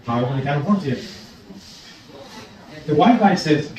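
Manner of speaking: ordinary delivery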